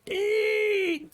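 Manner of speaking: long, very high-pitched squeal; horrible bug squeal. Pretend. Game master voices the bug as it goes down, horrible squeal. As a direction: squeal a drawn-out "eee" like a dying bug. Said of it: "That was a horrible bug sound".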